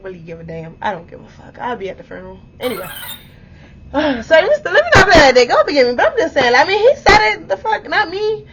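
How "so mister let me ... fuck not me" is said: high, loud flustered defence; protesting squeal. Real, not acted. Woman telling viewers he said it first, high protesting. Catches herself, protests her innocence.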